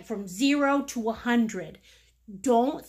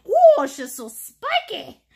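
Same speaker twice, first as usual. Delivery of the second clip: laughing delight; 4 semitones higher, 7 dB louder than usual. Real, not acted. Admiring the roof again.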